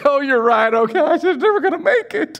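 high-pitched, hammed-up whimper of surrender; mock defeated whining. Mocking one who gives in.

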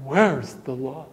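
mock complaint. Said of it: "see what happens".

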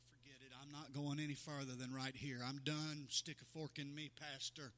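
soft, drained voice of someone giving up; quiet mock surrender to the pastor; weary quitter. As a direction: let it sag like you're finished. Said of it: "throw up your hands and quit and say".

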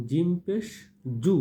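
hard, firm j on 'জু'; firm jim sound. Shown as a demonstration. Teacher models jim pesh for learners, a hard j in 'জু'. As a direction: press the j of 'জিম পেশ জু' firmly. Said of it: "একটু শক্ত করে বলবেন".

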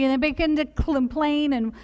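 ordinary talk

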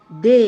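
দে loud and drawn out alone; isolated syllable. Shown as a demonstration. Teacher breaking a word down for students, isolated syllable. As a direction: punch out দে and hold the vowel. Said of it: "কিভাবে দেখে নিই একটু".

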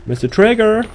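high, loud call that leaps upward. Pretend. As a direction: call for him as if across a hall. Calls out to the game's villain.

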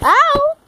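sudden high pained yelp. Real, not acted. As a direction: yelp like the ball stung. Hit by a ball again.

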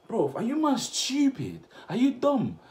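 Bro, are you dumb, shouted high; outraged disbelief. Real, not acted. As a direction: call him out, stunned. Outraged at comment.

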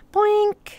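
high-pitched "boink" sound effect; cartoonish high boink; playful boink. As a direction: pop out a high little boink. Playful imitated noise.

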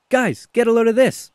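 high-pitched call to the flock; emu calling out. Acted. Emu calling the flock to a hole in the fence, high.

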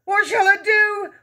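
slow, high, loud wail. A narrator's reading. Father desperate about his hair, wailing. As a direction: wail it in panic. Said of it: "wailed the father".

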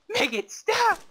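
very high, loud wail; mock agonized plea. Player begging for it to end after being hit, mock screaming. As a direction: scream it out in hammed-up agony.

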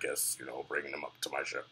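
very low, quiet masked villain rumble; Kylo Ren voice. Retold or imitated. Reviewer imitating the new masked villain, very low and soft. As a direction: sink deep and soft behind a mask. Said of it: "He's got a cool voice".

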